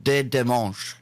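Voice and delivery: best attempt at French pronunciation; French accent. Shown as a demonstration. Host showing off to co-host the French newspaper's name, French accent. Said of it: "That's my best French accent".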